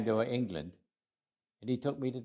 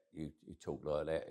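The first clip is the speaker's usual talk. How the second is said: put-on showing off his old thick Cockney accent, a bit quieter; 5 semitones lower, 10 dB quieter than usual. Shown as a demonstration. Man recalling his youth, demonstrating his Cockney accent. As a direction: drop into broad Cockney. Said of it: "I had a thick Cockney accent".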